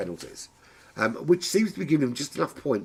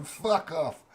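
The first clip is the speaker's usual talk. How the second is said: higher-pitched attempt at an Australian heckler; mock Australian yell; 7 semitones higher than usual. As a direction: put on a rough Australian accent, raised pitch. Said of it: "Australians out there screaming".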